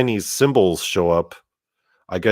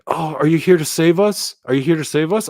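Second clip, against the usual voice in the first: put-on higher voice for scared children begging to be saved; pleading kids; 8 semitones higher than usual. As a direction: play frightened kids pleading for rescue. Kids begging him for rescue.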